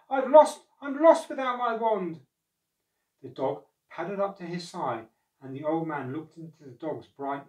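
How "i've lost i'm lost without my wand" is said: loud, high lament; raised, despairing old man's plea; distraught cry. A narrator's reading. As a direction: cry out as if all is lost. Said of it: "he cried".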